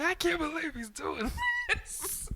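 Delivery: very high mock-distraught voice; mom freaking out. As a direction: imitate a mother wailing in disbelief, breaking into laughter. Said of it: "like, she's freaking out".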